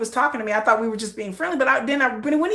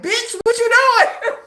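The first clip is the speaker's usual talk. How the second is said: high, drawn-out scolding through laughter; hammed-up indignation, breaking into a laugh; mock outrage; 9 semitones higher, 4 dB louder, slower than usual. Jealous Sugar confronts her over the dream, laughing mock outrage.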